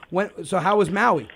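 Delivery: normal talking voice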